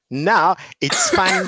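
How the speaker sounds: slow, stressed now it's fun; emphatic fun. Real, not acted. Teacher telling the host teaching is fun, emphatic. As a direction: lean hard on fun.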